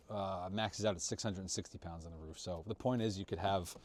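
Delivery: talking through the cold, teeth chattering; shivering, struggling to get words out. Real, not acted. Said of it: "Struggling to talk 'cause it's so cold".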